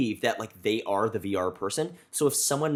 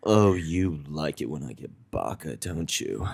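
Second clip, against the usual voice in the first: low teasing voice; 6 semitones lower than usual. Retold or imitated.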